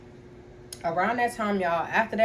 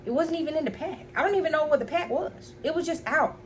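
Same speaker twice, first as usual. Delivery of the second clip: ordinary delivery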